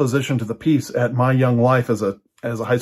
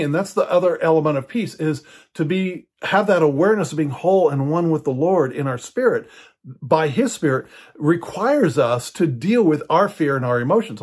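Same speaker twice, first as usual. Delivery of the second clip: ordinary talk, nothing marked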